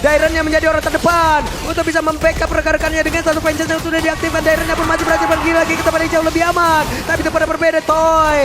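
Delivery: steady play-by-play narration; esports caster. Acted. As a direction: call the fight move by move. Live play-by-play casting.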